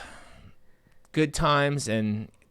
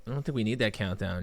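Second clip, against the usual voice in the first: ordinary delivery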